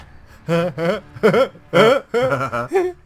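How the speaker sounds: high wheezing cackle; helpless shrieking laugh; wild rambling cackles. Real, not acted. Laughing at camouflage joke.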